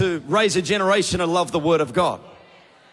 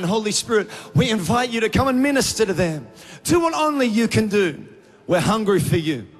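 raised, earnest prayer voice; fervent prayer; 4 semitones higher than usual. Acted. Pastor praying over the congregation, fervent and earnest. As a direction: lift the church up in heartfelt prayer.

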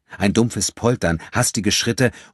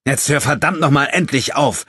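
much higher and louder, cutting command; sharp; 9 semitones higher, 6 dB louder than usual. A narrator's reading. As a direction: snap at him to stop now. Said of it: "sagte André scharf".

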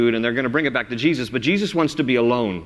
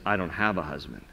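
softer, lower, guarded answer; quiet denial; 6 semitones lower, 8 dB quieter than usual. Pretend. The Samaritan woman quietly denying she has a husband. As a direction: say it small and closed off.

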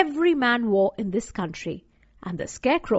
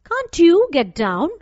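put-on higher, louder little girl asking; girl's voice; 6 semitones higher, 6 dB louder than usual. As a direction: raise the voice into a young girl's question. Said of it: "asked Dorothy".

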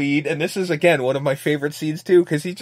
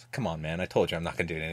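quiet coaxing; 10 semitones lower, 12 dB quieter, much faster than usual. Retold or imitated. Quoted brother, very soft.

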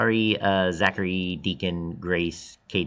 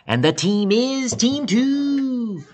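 drawn-out, much higher and louder British-accent reveal; excited announcement; 7 dB louder, slower than usual. Real, not acted. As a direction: stretch it out like a game show host. Announcing the chosen team.

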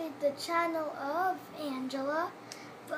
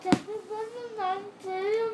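cheeks stuffed full; 5 semitones higher, 3 dB louder than usual. Real, not acted. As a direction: mouth crammed with marshmallows. Marshmallows stuffed in mouth.

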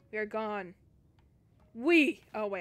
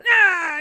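loud, piercing scream of fright; sudden loud shriek; terrified scream; 7 semitones higher, 19 dB louder than usual. Real, not acted. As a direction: scream out in terror. Monster grabs at her.

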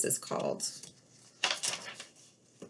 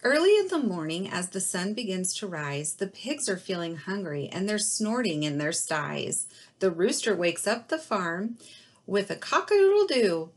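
put-on reading to children; 4 dB louder than usual. Reads picture book to kindergarten.

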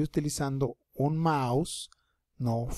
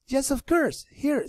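put-on much higher girl's voice, bright and willing; voicing the girl; 11 semitones higher, slower than usual. Teacher plays the girl answering the boy, higher. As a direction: pitch up to play the girl agreeing. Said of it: "Y la niña le contesta".

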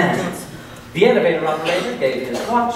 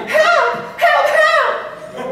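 high desperate cries; trapped passengers crying for help; much slower than usual. Acted. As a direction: call out high for rescue, over and over. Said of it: "They all cried".

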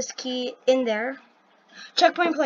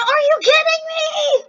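very high, loud shriek; outraged scream; 14 semitones higher, 8 dB louder than usual. Real, not acted. Player failing at the countdown's end, outraged scream. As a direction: scream it in disbelief, holding the end.

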